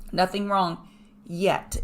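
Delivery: slow, with "yet" set apart and emphasized; emphasis on the final word "yet"; stress on "yet". Real, not acted. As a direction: hold back, then land firmly on "yet". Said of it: "Emphasis on the word yet".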